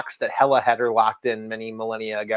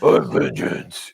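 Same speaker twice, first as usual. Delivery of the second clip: slow, gruff lightly done Batman; a hint of brooding Batman growl; much slower than usual.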